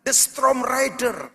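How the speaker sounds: loud, fervent sermon over music; full-force preaching. Acted. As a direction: preach it out, pushing the congregation. Preaching climax to amen call.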